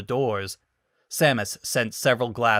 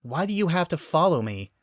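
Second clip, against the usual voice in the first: frustrated question, higher; exasperated Samus; 6 semitones higher than usual. Acted. Samus snapping at the clinging Metroid. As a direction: let the irritation rise in the question. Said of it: "Samus said".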